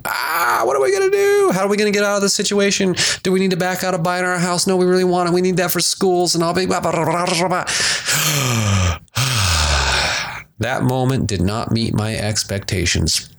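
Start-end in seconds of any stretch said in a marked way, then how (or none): 0.0-7.6 high, fast, frantic worrying; hammed-up panic over the failed sale; mock panic. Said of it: "crisis mode". 7.7-10.5 long weary sigh. Real, not acted. After retold panic.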